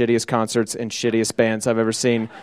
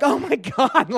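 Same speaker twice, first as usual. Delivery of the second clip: mock panic; 11 semitones higher, slower than usual. Retold alarm at incriminating shirt.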